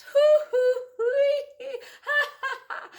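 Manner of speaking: high, loud, wheezing laughter; big belly laugh. Acted. Flower man being tickled under the chin, laughing hard. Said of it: "The flower man laughed and laughed".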